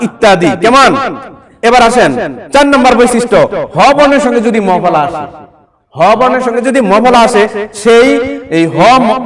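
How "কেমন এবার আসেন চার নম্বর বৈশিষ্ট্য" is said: ordinary talk, nothing marked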